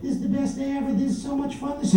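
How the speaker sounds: high, excited little squeal of delight; giddy popper users. Acted. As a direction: gush high and giddy over the best day. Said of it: "So they'll be like".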